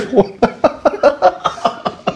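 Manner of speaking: a high, breathless laugh; laughing at your own joke; breathy laugh. Real, not acted. Joke about bartending.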